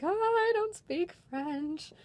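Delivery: high, slow, drawn-out princess reply; retold sheepish admission. Performer admits to a French-speaking kid, high and sheepish. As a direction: stretch it out high, as if caught out.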